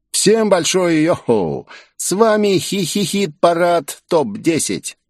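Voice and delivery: high, slow showman announcer voice; flashy TV host. A narrator's reading. Glitzy host greeting viewers in a high, slow showman voice. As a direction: hype up the chart like a glitzy host.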